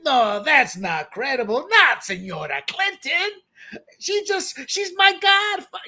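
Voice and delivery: lightly hammed-up disbelief, climbing very high; mock indignant official. FBI waving off the warning about Clinton, mock indignant. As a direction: protest in a mock high voice, rising as it goes.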